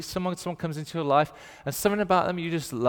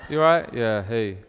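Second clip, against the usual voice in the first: low northern greeting; 3 semitones lower, 5 dB louder than usual. Retold or imitated. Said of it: "Like, low voice, slightly depressed".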